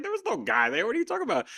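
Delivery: high, incredulous protest; baffled wife. Retold or imitated. Wife baffled by his hospital visions, high and incredulous. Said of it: "she was like".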